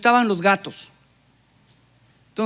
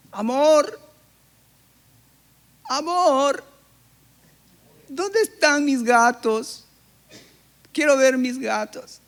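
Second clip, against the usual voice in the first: higher, slow wife's voice calling out; 4 semitones higher, slower than usual. Acted. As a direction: call sweetly for your husband, drawing out the question. Wife asks for her cats. (esposa llamando a su amor, voz más aguda y lenta)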